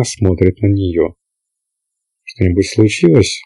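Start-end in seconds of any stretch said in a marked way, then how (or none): none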